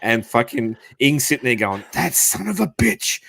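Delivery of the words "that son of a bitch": mock cursing. Retelling Ing's reaction to the trolling, mock-outraged. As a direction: imitate someone cursing, pitched up and amused.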